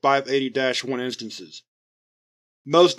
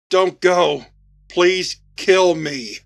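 louder, higher, slow begging cry; desperate plea; 4 semitones higher, 4 dB louder, much slower than usual. Acted. Creature crying after the fleeing soldiers, desperate plea. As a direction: beg them not to leave. Creature pleads as they flee.

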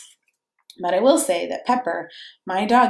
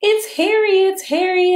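high, loud joyful cry; children crying out happily; excited cry; 12 semitones higher, 5 dB louder than usual. Acted. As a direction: shout it with delight. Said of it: "they cried".

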